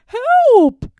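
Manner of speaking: high, loud cry for help. Acted. Toad cries out as his list blows away, loud and high. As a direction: yell it as the list flies off. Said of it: "cried Toad".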